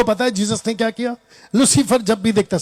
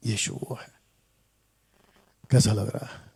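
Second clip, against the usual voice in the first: hushed, lower whisper; 10 semitones lower, 10 dB quieter than usual. Acted. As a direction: breathe it like a secret. Preacher drops to a whisper.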